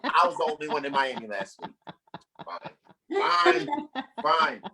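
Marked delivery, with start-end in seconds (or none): none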